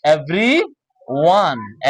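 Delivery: everyone very slowly, every then one; drawn-out teaching everyone; broken into parts. Shown as a demonstration. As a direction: leave a clear gap before the one in everyone. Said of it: "বাক্য করে করবো।".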